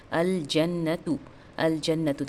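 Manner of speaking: slow "अल जन्नतु" with every syllable apart; deliberate model reading of "अल जन्नतु", clear l; clear lam. Shown as a demonstration. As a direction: sound the l fully in "अल जन्नतु". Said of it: "लाम का sound clear आ रहा है".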